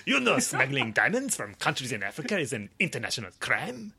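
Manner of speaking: high, loud, hammed-up French police captain; French captain interrogating a suspected smuggler, outrageous accent. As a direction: ham up a bad French accent through laughter. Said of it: "What is this outrageous accent?".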